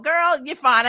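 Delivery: ordinary delivery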